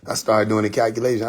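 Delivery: ordinary talk, nothing marked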